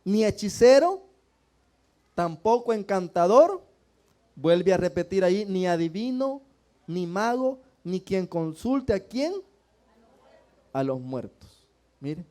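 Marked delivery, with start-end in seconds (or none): 0.0-8.9 loud, measured Bible reading from the pulpit; scripture reading. Acted. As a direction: proclaim each verse to the whole church. Reads scripture aloud to the congregation. (lectura bíblica en voz alta y solemne)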